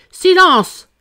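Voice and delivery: high, loud cry for silence; shouted command. A narrator's reading. King to the courtroom, shouting for order.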